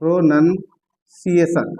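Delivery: Pronunciation drawn out syllable by syllable, very slowly; slow, spelled-out word. Shown as a demonstration. Teacher says the word slowly while writing it for students. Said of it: "यहाँ मैं लिख देता हूँ pronunciation".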